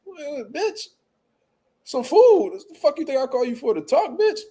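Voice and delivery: high, heated talking back; high exasperated retort; mock angry comeback. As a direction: snap back at her. Retorting angrily to phone lady.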